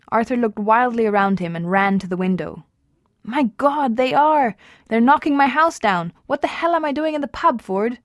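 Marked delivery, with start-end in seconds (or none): 3.2-8.0 panicked. A narrator's reading. Arthur sees his house demolished.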